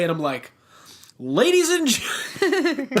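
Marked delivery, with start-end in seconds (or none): 1.2-2.9 wrestling announcer. Pretend. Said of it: "wrestling announcer who just got fed up".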